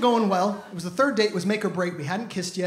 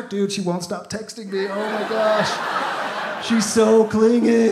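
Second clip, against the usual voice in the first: annoyed guy; slower than usual. Retold or imitated. Guy complaining to friend.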